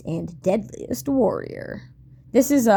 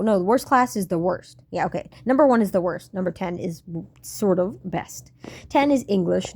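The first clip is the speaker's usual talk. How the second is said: normal talking voice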